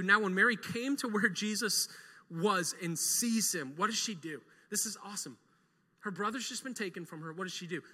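usual speaking voice

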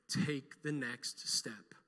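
slow, deliberate command. Retold or imitated. God's closing word, voiced slowly and gravely. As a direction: spell it out step by step. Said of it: "he ended this way".

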